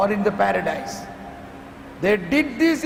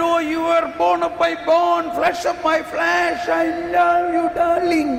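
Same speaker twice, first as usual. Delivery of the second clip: fond Adam; 5 semitones higher than usual. Acted. Adam voiced praising Eve.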